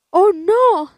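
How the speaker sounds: high, loud startled "oh, no"; alarmed cry. A narrator's reading. Tortilla refuses the hungry old man, alarmed cry. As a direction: recoil in alarm. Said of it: "dijo la tortilla".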